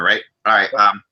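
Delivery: normal talking voice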